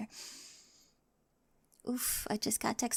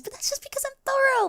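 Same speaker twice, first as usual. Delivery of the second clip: high, loud, rushed; embarrassed high-pitched defense; flustered excuse; 6 dB louder than usual. Acted. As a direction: squeak out the excuse.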